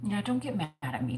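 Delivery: ordinary delivery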